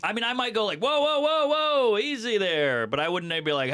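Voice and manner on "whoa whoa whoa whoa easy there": high startled whoa, easing into calm; mock alarm. To a barber cutting wrong, a startled high whoa. Calming a barber mid-cut.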